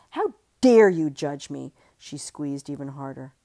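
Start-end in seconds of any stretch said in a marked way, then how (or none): 0.1-1.7 loud furious outburst; loud raging cry. Acted. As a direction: hurl it in rage. Strangling fight, rage.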